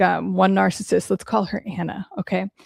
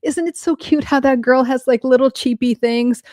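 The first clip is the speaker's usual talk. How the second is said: high, mock-sweet condescension; imitating a catty, superficial woman; imitated snide friend; 5 semitones higher than usual. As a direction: put on fake cuteness to belittle someone. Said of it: "and then she'd be like".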